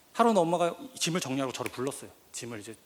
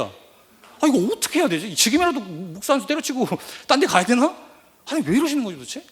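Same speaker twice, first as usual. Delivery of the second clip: anguished, higher and loud sermon climax; full-force preaching; 10 semitones higher, 11 dB louder than usual. Acted. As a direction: cry out to God in desperation. (절규하듯 힘차게 설교하며)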